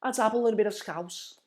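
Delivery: playful exaggerated Scouse lilt; Scouse accent. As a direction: put on Scouse, pitched well up. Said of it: "quite a good Scouse accent".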